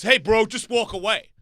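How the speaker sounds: loud, high order; retold bystander butting in forcefully; imitated intervention. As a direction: call out to break up a fight.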